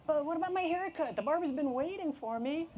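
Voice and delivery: put-on high, wheedling kid voice; pleading whine. Boy pleading with mom for his haircut, whining. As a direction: keep it high and imploring.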